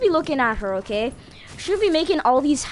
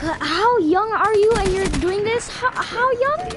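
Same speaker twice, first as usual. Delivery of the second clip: disbelief; 8 semitones higher, slower than usual. Retold or imitated. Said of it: "I'm like".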